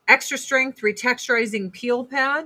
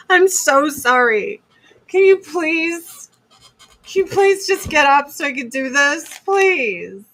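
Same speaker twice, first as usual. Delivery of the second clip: higher, louder whiny begging; hammed-up pleading; 6 semitones higher, 6 dB louder than usual. Waking her husband to wash bloody sheets, whiny mock plea. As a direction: beg him to get up, overplayed.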